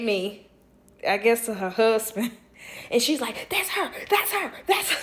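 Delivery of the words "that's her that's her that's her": high, urgent repeated exclamation; squeaky mock excitement; excited pointing out. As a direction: imitate a woman alerting her husband. Woman points her out to husband.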